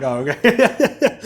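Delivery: high, slow startled oh okay; drawn-out surprised reaction. Retold or imitated. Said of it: "I'm like".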